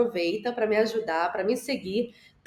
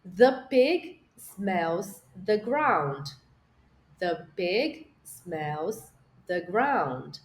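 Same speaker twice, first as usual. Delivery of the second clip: slow model sentence; 2 semitones lower, much slower than usual. Shown as a demonstration. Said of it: "Bora já pular pra frase dois".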